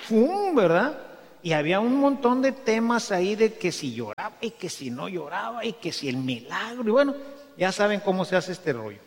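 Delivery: normal talking voice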